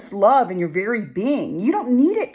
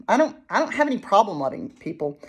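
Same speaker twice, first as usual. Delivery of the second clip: usual speaking voice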